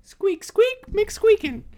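high squeaky toy voice; squeaky rubber duck. Pretend. Mocking the squeak inscription.

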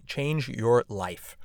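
slow, spaced "change your life"; slowly stressed "change your life"; very slow emphasis. Real, not acted. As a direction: drag each word out for weight. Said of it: "I'm telling you".

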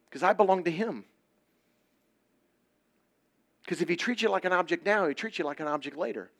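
loud, high, urgent preaching; fiery youth preacher pleading; preaching at full force. Real, not acted. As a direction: drive each line home to the crowd.

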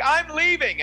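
high, loud mock walkout; indignant announcement. Imitating a crowd member storming out, mock-indignant call. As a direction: announce it to the whole room, hammed up.

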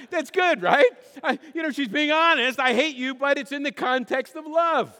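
high, loud and amused through laughter; laugh, then crack up through the words; laughing delivery. Real, not acted. Laughs at his own note story.

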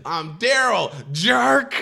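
hammed-up indignant comeback; petulant quote, jumping up on the insult; mock anger. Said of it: "He was so mad".

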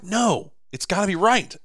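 high, insistent no to the lazy voice; firm refusal. Retold or imitated. Man refusing his inner voice's shortcut, high firm insistence. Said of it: "And I'm like".

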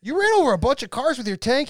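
high, loud disbelieving question; retold incredulity. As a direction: react in mock amazement, pitched right up. Incredulous friends in a retold story.

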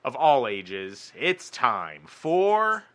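slow, booming showman announcement; announcer voice. Acted. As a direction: stretch each word like a ringmaster. Announcing the segment, music.

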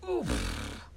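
low winded oof; oof grunt. A narrator's reading. Girl falling face-first in the dirt, low oof. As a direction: grunt as she hits the ground. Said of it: "She went".

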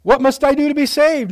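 loud, high, urgent question; Nicodemus. Acted. Acted seeker asking Jesus about salvation, urgent questioning. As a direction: call out the question emphatically. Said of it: "Nicodemus asked".

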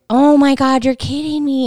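high, loud mock-shocked outburst; retold disbelief. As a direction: exclaim it as if shocked by gossip. Retold shocked reaction to salon story.

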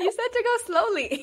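laughing while talking; giggling through the words. Real, not acted. Both laughing at the story.

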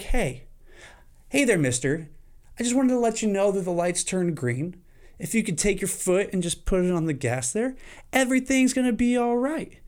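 polite nudge. Pretend. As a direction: kindly tell the driver the light is green. Voicing the polite horn.

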